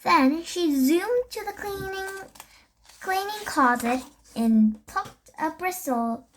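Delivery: usual speaking voice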